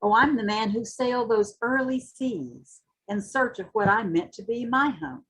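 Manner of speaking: usual speaking voice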